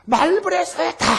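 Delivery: high mock shout of 말벌에 쏘였다; reenacted cry of pain after a wasp sting; retold yell. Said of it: "이렇게 소리치세요". (말벌에 쏘인 순간의 외침)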